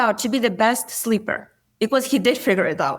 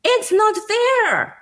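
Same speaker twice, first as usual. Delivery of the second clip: put-on whiny child voice; 8 semitones higher than usual. Son in the back row complaining to mom, high childish whine. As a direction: play the upset little boy crying it's not fair. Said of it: "he's like".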